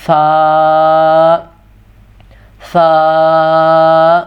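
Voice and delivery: سا drawn long and smooth, no whistling hiss. Shown as a demonstration. Quran teacher modelling سا for beginners, long and smooth. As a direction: recite سا slowly with the tongue between the teeth. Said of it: "سیٹی والی آواز نہیں آنی چاہیے".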